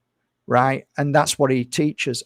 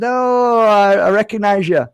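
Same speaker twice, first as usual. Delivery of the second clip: a bright, high, louder "oh" of recognition; retold surprised greeting; 6 semitones higher, 5 dB louder than usual. Recognizing the influencer at his table, surprised and high. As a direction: play the startled fan who spots him.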